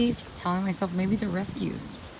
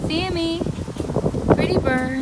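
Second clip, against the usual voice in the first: high, louder singsong coo; pet talk; 9 semitones higher, 6 dB louder than usual. Real, not acted. Cooing to the parrot Sammy, high singsong. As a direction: sweet-talk the bird up high.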